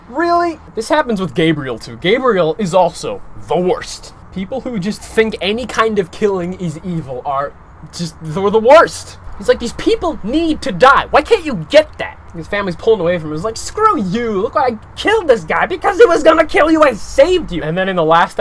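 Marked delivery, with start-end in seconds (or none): 0.1-0.6 incredulous. Real, not acted. Incredulous reaction to family. 9.6-17.6 higher, louder, heated defense; angry outburst. Retold or imitated. Abraham defending killing to family.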